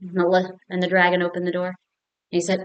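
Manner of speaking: ordinary delivery, nothing marked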